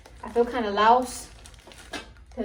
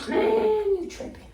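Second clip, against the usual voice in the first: exasperated; 11 semitones higher, 3 dB louder, slower than usual. Real, not acted. Frustrated with stuck piece.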